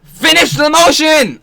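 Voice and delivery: screamed high and loud at the screen; furious shout. Real, not acted. Yelling at the broken animation in real fury. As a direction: let it rip in real frustration.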